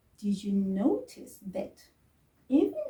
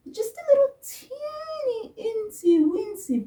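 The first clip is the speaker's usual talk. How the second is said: way up high, cutesy small voice; teeny tiny voice; 13 semitones higher, 5 dB louder than usual. Pretend. Teacher playfully squeaks about a tiny pause. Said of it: "high teeny voice".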